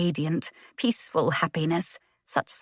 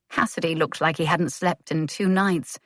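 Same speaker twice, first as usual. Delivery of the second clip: ordinary delivery, nothing marked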